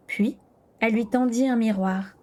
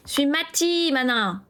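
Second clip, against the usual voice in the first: put-on a light, higher child's voice calling out; little boy voice; 5 semitones higher, slower than usual. A narrator's reading. A small boy calls to Kira, lightly voiced. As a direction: toss it out like a proud small boy. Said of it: "lança-t-il".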